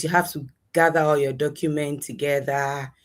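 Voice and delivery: usual speaking voice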